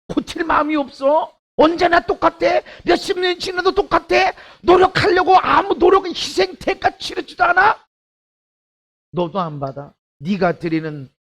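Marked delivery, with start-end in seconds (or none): 0.1-7.9 higher, pressing questions; preaching rebuke. Acted. Pastor voices God demanding repentance, rising preaching. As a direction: press each question harder.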